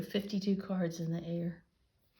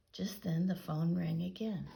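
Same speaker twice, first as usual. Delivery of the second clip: plain reading voice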